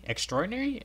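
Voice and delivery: high incredulous echo; surprised repeat. Real, not acted. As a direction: fling it up in surprise. Repeating the word.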